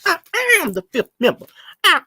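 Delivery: hammed-up imitation, high and mocking; mocking impression. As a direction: parrot her pompously. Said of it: "she kept on saying how she the fifth member".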